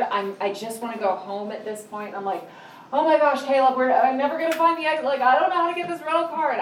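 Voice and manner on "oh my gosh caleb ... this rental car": high, fast flustered panic; panicked. Retold or imitated. Lost mother driving in circles to her son, fast panicked. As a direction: rattle it off pitched up in a panic. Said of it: "absolutely in a panic".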